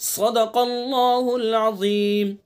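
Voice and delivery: slow melodic tajweed chant; Quran recitation. Shown as a demonstration. Teacher reciting the verse slowly for students. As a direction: draw each syllable out in recitation.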